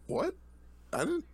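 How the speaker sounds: high mock outrage. Mock accusing a friend.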